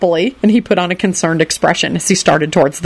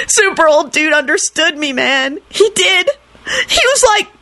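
high, tearful whine climbing higher; shrieked lament; 11 semitones higher than usual. A narrator's reading. Kenny remembers being understood, wailing. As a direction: wail it, pitch rising in grief. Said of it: "Kenny shrieked".

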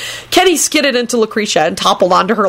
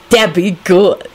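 easy, satisfied approval; complacent; slower than usual. A narrator's reading. Lucretia approving Rosie's plan, complacently. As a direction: approve with mild self-satisfaction. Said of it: "Lucretia said complacently".

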